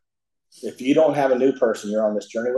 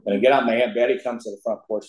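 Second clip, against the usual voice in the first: ordinary talk, nothing marked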